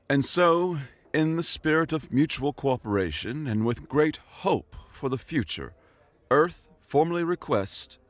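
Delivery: high, careful diplomatic speech; formal ambassador. A narrator's reading. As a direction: address the assembly with hopeful formality.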